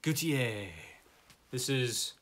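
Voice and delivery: slow, hammed-up French on "Gautier"; a playful stab at a French accent on "Gautier"; mock-French "Gautier", drawn out. Shown as a demonstration. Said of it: "my French accent isn't so good".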